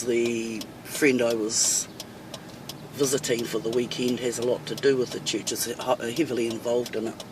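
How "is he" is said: usual speaking voice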